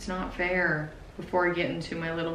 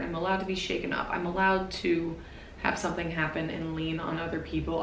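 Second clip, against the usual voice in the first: ordinary delivery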